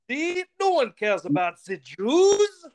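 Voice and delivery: slow, loud, high German accent; hammed-up Hitler with heavy German accent. Shown as a demonstration. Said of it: "You have to say ... Like that".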